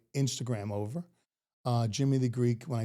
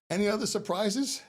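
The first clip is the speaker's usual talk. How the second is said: mock agent question; 9 semitones higher than usual. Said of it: "So I'm like".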